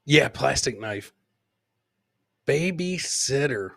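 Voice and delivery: normal talking voice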